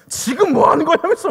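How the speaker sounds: high, loud retold challenge; mock scolding. Evangelist challenging temple-goers, mock scolding. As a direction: imitate an outraged demand, voice shooting up. Imitates evangelist rebuking subway passenger.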